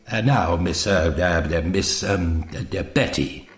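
low, soft, stammering hesitation; hesitant, fumbling little man. A narrator's reading. Doctor addresses Betty for the first time, haltingly. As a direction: fumble for the name in a low mumble.

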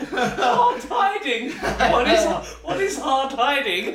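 loud laughing disbelief. Real, not acted. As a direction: crack up while asking. Mocking friend's odd phrase.

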